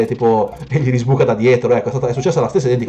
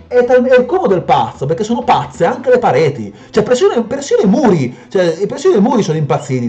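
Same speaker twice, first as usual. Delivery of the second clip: high-pitched, excited ranting; worked-up, high-voiced gamer rant; incredulous outburst; 9 semitones higher than usual. Real, not acted. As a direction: exclaim in disbelief at the madness. Reacting to crazy moving walls.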